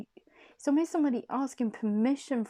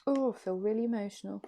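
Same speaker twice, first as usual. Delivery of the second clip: emotional; 3 semitones higher than usual. Real, not acted. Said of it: "and I'm crying".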